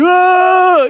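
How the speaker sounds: high, loud wail of grief; wailing cry. Retold or imitated. Israel wailing in despair, a loud high cry. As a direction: let out a mourning wail.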